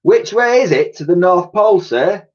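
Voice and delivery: high, eager little voice; piped up. A narrator's reading. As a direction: pipe it up like a keen young sailor. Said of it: "piped up Titch".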